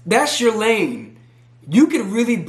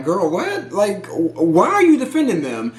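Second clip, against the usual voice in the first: taken aback. Retold or imitated. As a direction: act out someone mildly thrown, questioning her. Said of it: "[name] was taken aback".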